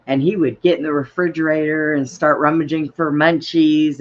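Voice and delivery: normal talking voice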